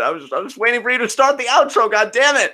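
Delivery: high, loud, quick protest; flustered, hammed-up outburst; mock exasperation. Host defends himself over the outro, mock exasperation.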